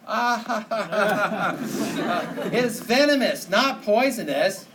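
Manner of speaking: loud gloating laugh, then a smug correction; gloating mock laugh. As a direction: cackle at the friend, then rub it in slowly. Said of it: "and you go".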